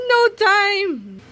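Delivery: high snappy "No time!"; villain shout. Acted. Monster villain cuts off the list, high snappy shout. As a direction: cut in high and sharp.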